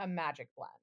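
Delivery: ordinary talk, nothing marked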